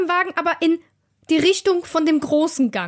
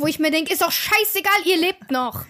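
ordinary delivery

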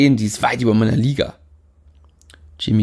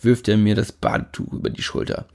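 ordinary delivery, nothing marked

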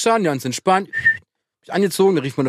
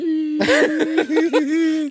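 high laugh; 10 semitones higher than usual. Real, not acted. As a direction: burst of high-pitched laughter. Friends joking about wedding.

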